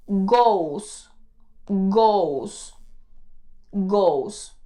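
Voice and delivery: "goes" said as one syllable, gous; pronounce goes. Shown as a demonstration. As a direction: say "goes" carefully with an S. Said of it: "se le pone E S y se dice".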